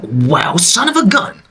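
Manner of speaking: squeaky high amazed exclamation; wide-eyed wow; amazed reaction. Retold or imitated. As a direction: jump way up in pitch like it just clicked. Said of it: "I was like".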